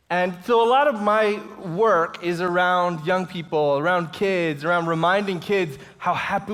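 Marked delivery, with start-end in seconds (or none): none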